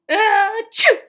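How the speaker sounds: loud high "ah, chew!" sneeze; acted sneeze. Gerald sneezing while explaining, loud burst. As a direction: build up and explode into a sneeze.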